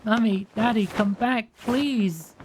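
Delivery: high, loud, slow wailing plea; dying girl crying out for mummy and daddy; desperate cry. Acted. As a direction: scream for them to come back. Girl screams after her parents.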